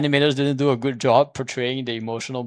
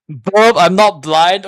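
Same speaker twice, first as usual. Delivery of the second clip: exasperated retort; 4 semitones higher, 8 dB louder, much slower than usual. Real, not acted.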